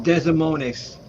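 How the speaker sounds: "Des Moines" said as "DEZ-uh MOH-niss"; mispronounced Des Moines. Real, not acted. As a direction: sound out every letter of "Des Moines", slowly. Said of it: "Or how do you pronounce that?".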